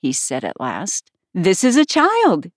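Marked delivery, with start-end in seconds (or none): 1.3-2.5 high, eager introduction. A narrator's reading. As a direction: pipe up eagerly to present someone. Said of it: "Hare replied eagerly".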